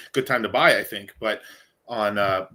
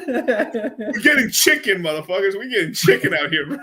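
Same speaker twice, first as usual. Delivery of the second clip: laughing hype; 10 semitones higher, 6 dB louder than usual. Real, not acted. Bragging to the crew about cashing in, laughing. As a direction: crow it out while cracking up.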